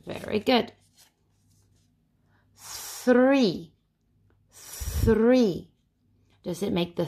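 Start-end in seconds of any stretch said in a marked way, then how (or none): 2.6-5.7 THREE high, opening on a long airy th; unvoiced TH. Shown as a demonstration. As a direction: hold the voiceless th starting THREE. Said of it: "Three is like mouth".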